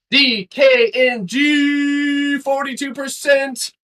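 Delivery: high, slow, loud announcer call; drawn-out excited shout. Real, not acted. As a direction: stretch it out like a win. Announcing trade profit.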